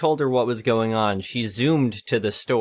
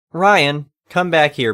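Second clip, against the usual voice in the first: raised call across the aisle; 5 semitones higher, 6 dB louder, slower than usual. Retold or imitated. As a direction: call the kid back from down the aisle. Calling son across aisle.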